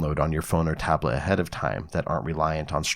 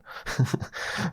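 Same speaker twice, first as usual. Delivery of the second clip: short breathy laugh; 7 semitones higher, 5 dB quieter than usual. Real, not acted. Laughs at own joke.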